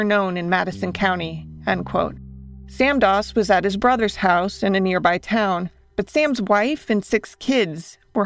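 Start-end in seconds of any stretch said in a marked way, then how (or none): none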